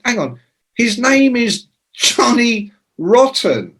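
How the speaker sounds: high, slow disbelief; disbelieving. Retold or imitated. Telling [name] his name really is [name], slow and incredulous. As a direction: spell out how absurd the name is.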